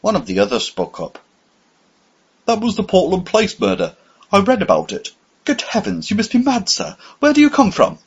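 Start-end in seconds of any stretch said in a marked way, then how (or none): 2.5-8.0 much higher, startled indignant voice; indignant young man. A narrator's reading. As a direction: protest in disbelief. Another man speaks up.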